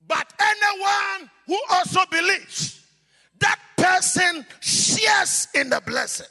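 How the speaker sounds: loud, high fervent sermon delivery; preaching at full force. Acted. Preacher blessing his congregation, preaching at full force. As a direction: proclaim the blessing over the whole church.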